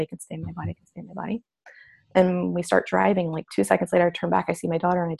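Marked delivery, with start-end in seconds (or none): 0.0-1.4 much softer, nearly breathed mantra; soft self-reassurance. Retold or imitated. In the car, calming herself after the confrontation, very soft mantra. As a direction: repeat it softly to calm yourself. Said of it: "talking myself back into my body".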